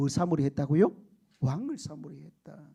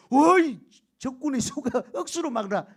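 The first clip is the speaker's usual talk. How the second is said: panicked enemy; 7 semitones higher, 6 dB louder than usual. Retold or imitated. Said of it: "이러면서".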